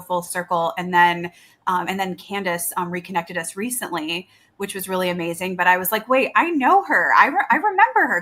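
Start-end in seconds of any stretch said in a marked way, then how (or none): 6.1-8.2 higher, louder burst of sudden recognition; excited recognition. Retold or imitated. Guest retelling her reaction to the host, excited. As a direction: relive the jolt of recognizing someone, pitch jumping up.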